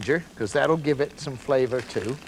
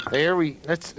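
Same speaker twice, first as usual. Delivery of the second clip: ordinary talk, nothing marked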